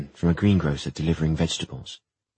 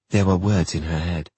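ordinary delivery

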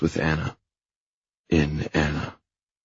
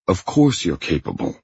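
exasperated. A narrator's reading. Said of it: "He sounds exasperated".

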